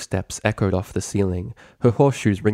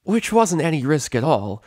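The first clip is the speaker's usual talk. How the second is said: cocky tomboy; 7 semitones higher, 4 dB louder than usual. Acted. Rainbow cocky.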